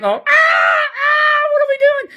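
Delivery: high, loud crowd shrieking; hammed-up panicked shout; mock panic. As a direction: imitate people scattering in alarm. Acting out panicked temple crowd.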